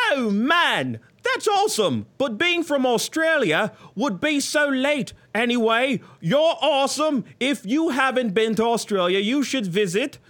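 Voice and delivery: put-on high, loose, far-off Australian accent; purposely botched Australian, hammed up; bad Australian accent. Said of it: "I'm gonna say this as if Johnny was speaking".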